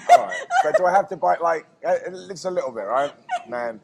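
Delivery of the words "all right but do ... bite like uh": laughing. Real, not acted. Biting a very hot chili.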